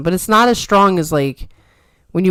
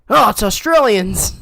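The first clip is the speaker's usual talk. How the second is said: higher, slower mock dismay; alarmed shark; 5 semitones higher, 4 dB louder than usual. Shark spotting Australians on the beach, mock alarm. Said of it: "And the shark's like".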